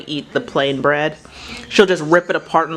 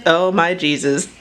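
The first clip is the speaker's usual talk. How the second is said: ordinary delivery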